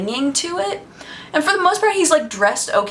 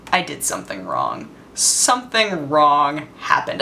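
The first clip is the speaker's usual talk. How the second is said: dread; 7 semitones lower than usual. Retold or imitated. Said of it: "I was like".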